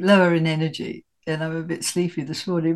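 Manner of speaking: normal talking voice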